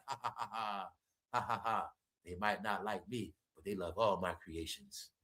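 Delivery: a low, soft gloat. Retold or imitated. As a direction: laugh it off like the man behind it all. Voicing [name] laughing.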